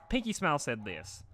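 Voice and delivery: plain reading voice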